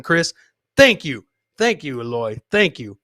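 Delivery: normal talking voice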